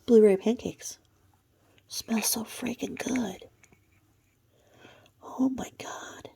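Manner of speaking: soft unvoiced whisper, savoring the smell; whispered delight. Real, not acted. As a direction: breathe out the words in delight.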